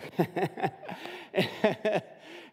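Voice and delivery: real laugh at own joke. Real, not acted. Pastor joking with the congregation, laughing. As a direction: chuckle after the punchline.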